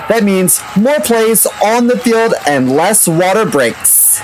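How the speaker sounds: upbeat sponsor read, sports-announcer energy. Acted. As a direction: sell it like a game-day hype reel.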